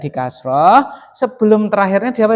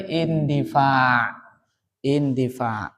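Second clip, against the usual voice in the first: "Indifa" low, soft and stretched out; softly dictated "Indifa"; slow model answer; 7 semitones lower, 7 dB quieter, much slower than usual. Shown as a demonstration. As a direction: draw out "Indifa" quietly, syllable by syllable. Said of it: "jadi apa berarti?".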